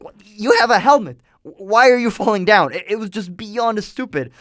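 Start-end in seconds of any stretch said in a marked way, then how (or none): 0.0-2.7 incredulous. Retold or imitated. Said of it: "and I'm like".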